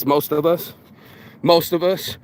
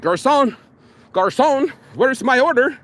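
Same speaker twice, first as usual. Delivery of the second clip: high, mock-entitled call to a waiter; imperious customer impression; 7 semitones higher, slower than usual. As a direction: summon the waiter as if snapping your fingers. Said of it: "trying to snap your fingers like".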